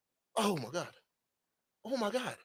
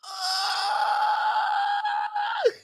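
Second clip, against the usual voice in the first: very high, breathless shrieking laugh; wild laughing shriek. Real, not acted. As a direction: let out a long screaming laugh. Streamer cracks up.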